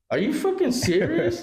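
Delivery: high, laughing incredulity; outraged but cracking up; Father laughing at his son's demand for money; laughing disbelief. Retold or imitated. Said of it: "I go".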